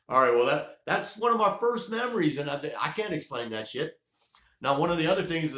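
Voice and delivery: loud, amazed aside to the listeners; loud outburst. Real, not acted. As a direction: blurt it out with disbelief. Bursts out after the story.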